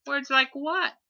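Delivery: little one asking innocently; innocent child. A narrator's reading. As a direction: ask without a clue.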